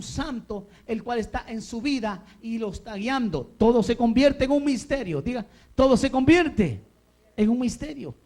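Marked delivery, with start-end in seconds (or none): 3.6-8.1 high, loud proclamation; full-force preaching. Real, not acted. Preacher has the church repeat a truth, high and loud. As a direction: ring it out for the congregation to repeat.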